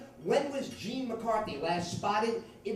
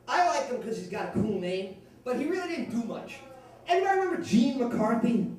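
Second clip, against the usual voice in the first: normal talking voice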